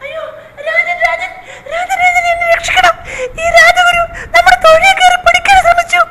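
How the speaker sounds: high, crying cry for help; panicked plea. Acted. Queen begs the king to save her, high crying wail.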